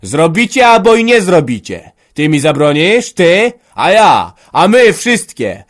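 loud, high, drawn-out shouting; furious quarrel. Acted. Father and son fight over the land, shouting furiously. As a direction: explode at each other, punching every retort. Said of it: "krzyknął, bo już nie mógł ścierpieć".

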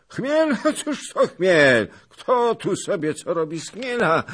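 loud, high, scornful laugh; a boastful old noble scoffing; proud scoffing. A narrator's reading. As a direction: sneer at the news with bravado. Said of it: "trzepał pan Zagloba, tocząc groźnie i dumnie".

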